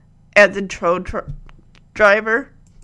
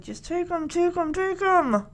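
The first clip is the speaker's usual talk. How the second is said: quick hushed aside, pitched up; hushed urging; 6 semitones higher, 6 dB quieter than usual. Retold or imitated. Jesse covering his bride's mouth, hushed urging. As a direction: urge it under your breath.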